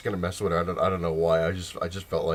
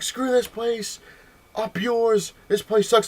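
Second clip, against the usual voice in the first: high-pitched mock indignation; imitated pissed-off customer; 13 semitones higher, 3 dB louder, much slower than usual. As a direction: act out a customer who's had enough. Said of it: "she got so pissed".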